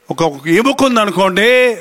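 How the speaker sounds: ordinary talk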